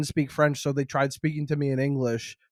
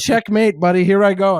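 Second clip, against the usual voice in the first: mock triumphant; 3 semitones higher, 7 dB louder than usual. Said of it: "I'd be like".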